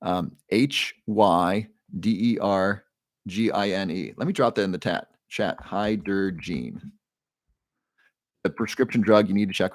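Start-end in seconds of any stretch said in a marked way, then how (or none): 0.5-4.1 letters spelled out slowly in small groups; slower spelling. Shown as a demonstration. Host re-spelling a drug name for a French viewer, slower. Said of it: "let me say that slower". 5.6-6.9 very slow, drawn-out syllables; slower. Shown as a demonstration. Host repeating a drug name for a French viewer, slower. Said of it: "let me say that slower".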